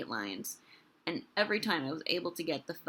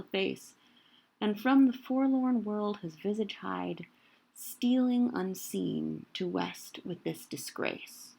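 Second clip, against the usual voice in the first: poem reading; 4 dB louder than usual. Shown as a demonstration. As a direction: read the sonnet aloud, feeling each beat. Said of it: "keep going here".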